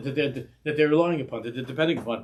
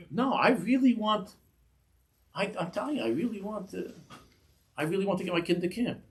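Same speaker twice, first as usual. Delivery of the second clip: pleading parent; 5 semitones higher, 3 dB quieter than usual. Retold or imitated. Parent pleading with camp secretary.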